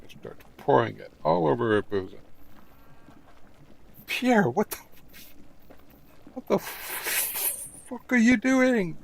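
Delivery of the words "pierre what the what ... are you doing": much higher, slow scandalized squeal; shocked Marie. Acted. Marie catching Pierre pouring wine, high slow outraged squeal. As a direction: shriek it as the outraged girlfriend.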